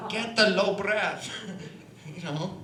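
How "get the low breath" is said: loud, slow coaching command; hammed-up old coach, loudly stretching each word; Olga. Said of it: "Olga should be sitting there with her cataract glasses on saying".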